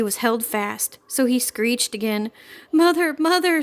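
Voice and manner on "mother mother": high, loud frantic scream; little girl shrieking for her mother in alarm. A narrator's reading. Girl screaming to her mother, high and loud. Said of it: "screamed Lucinda".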